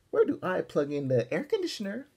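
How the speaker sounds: high, loud whiny holler. Acted. Muffy hollering from the limousine, high and loud. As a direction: call it out like a spoiled rich girl. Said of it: "she hollered".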